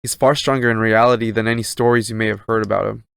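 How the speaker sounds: ordinary talk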